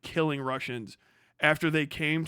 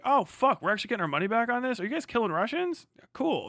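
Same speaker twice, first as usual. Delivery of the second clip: higher, suddenly interested; mock excitement; 6 semitones higher than usual. Americans hearing they get money back, mock excited. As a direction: play pleasantly surprised, lightly hammed up.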